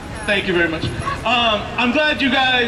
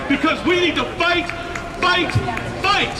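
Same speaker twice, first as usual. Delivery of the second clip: rousing rally cry; 6 semitones higher than usual. Real, not acted. Rallying the crowd to fight.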